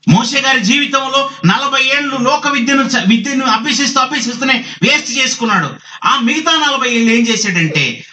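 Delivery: high-pitched, emphatic preaching; forceful preaching. Real, not acted. Preacher tells of Moses' wasted forty years, forceful preaching.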